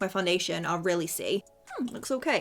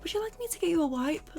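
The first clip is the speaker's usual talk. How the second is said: high, quick, rising polite offer; imitated shop assistant; 9 semitones higher, faster than usual. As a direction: mimic a helpful shop girl, high and fast.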